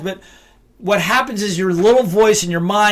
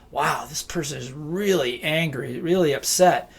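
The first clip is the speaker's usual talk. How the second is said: soft, lower, said to oneself; quiet inner voice; 3 semitones lower, 6 dB quieter than usual. Retold or imitated. Mediator thinking about an angry client, quietly. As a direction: mutter it privately to yourself. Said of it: "I'll say to myself".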